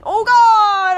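high, very loud wail; loud, drawn-out game-death yell; death cry. Real, not acted. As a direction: cry out as the dragon strikes. Killed by the dragon mid speech.

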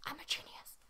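whispered, dry self-mockery; hushed ironic boast; sarcastic whisper. Real, not acted. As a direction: breathe it as a sly joke to yourself. Sarcastic aside after tongue twister.

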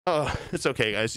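ordinary delivery

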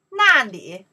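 ordinary talk

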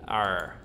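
low dinosaur growl. Pretend. Dinosaur grumbling at the cowboy, low growl.

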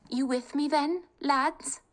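rally the family, high and eager; spirited young woman. Acted. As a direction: bold daughter talking her family round. Kate voiced.

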